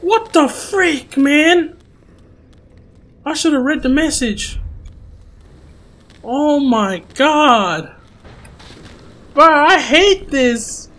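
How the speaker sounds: high, drawn-out complaint; exasperated. Real, not acted. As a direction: wail it slowly in frustration. Upset after being killed by scare.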